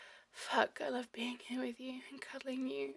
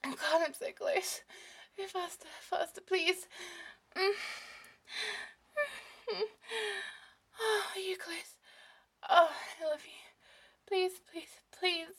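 breathless, higher-pitched begging; urgent pleading; 7 semitones higher, 5 dB louder than usual. Acted. Lover pleading with a partner, breathless and urgent. Acted love scene, building urgency.

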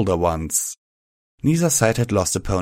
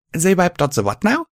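much higher puzzled question; confused; 10 semitones higher, 3 dB louder than usual. A narrator's reading. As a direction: ask it baffled. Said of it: "I asked, confused".

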